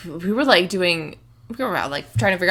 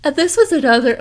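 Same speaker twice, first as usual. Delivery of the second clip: words stretched by a yawn; talking through a yawn; 7 semitones higher, 5 dB louder than usual. Real, not acted. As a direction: yawn while speaking. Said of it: "I'm sorry I'm yawning so much".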